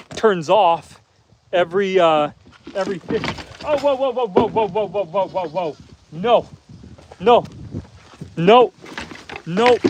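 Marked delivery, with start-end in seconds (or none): 3.6-9.8 rapid run of whoas falling in pitch, then short firm noes; urgent warning. Real, not acted. Farmer heading off an escaping animal, urgent warning. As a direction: stop the animal with repeated calls.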